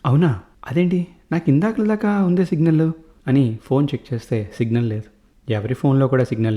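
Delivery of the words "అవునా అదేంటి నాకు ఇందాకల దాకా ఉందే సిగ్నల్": surprised. A narrator's reading. Father surprised at signal.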